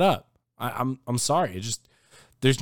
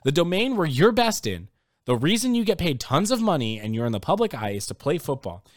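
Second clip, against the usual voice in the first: ordinary talk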